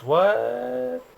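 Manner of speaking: sharply high, stunned "What?"; shocked what. Real, not acted. Viewer reacting to a wild scene, shocked and pitched high. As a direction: let the disbelief jump up.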